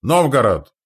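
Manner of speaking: loud, high identifying cry; indignant carrying shout; angry shout. A narrator's reading. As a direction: yell it across at the attackers. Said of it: "прокричал зло Назар".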